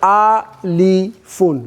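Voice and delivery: Alifun drawn out slowly, ending on a clear -un; teacher modelling Alifun slowly; slow spelled-out Alifun. Shown as a demonstration. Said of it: "what did you say?".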